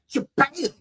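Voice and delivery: high, punched "Shabam"; imitated impact. Preacher imagining passing out, mock thud. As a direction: burst out a mock crash noise. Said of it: "Maybe I'll fall back".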